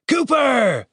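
high, urgent shout. A narrator's reading. Dave calls off his raging friend, shouting. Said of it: "he shouted".

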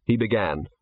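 ordinary delivery

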